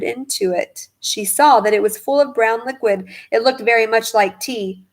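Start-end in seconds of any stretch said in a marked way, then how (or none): none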